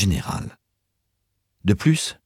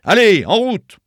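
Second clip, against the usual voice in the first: high, loud driving order; forceful urging shout; shouted command; 14 semitones higher, 9 dB louder than usual. A narrator's reading. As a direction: yell at the dog team to set off. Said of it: "cria-t-il".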